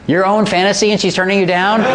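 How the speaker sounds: can't believe what he hears; amused disbelief at a student; incredulous. Retold or imitated. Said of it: "I'm like".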